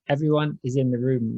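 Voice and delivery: normal talking voice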